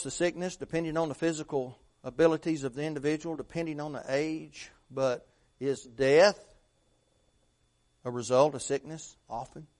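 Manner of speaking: ordinary delivery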